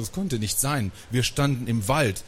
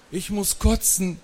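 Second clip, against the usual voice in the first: high-pitched wail; plaintive, pitched-up complaint; whining; 9 semitones higher than usual. A narrator's reading. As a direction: whine that you feel sick. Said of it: "jammerte die dreizehn".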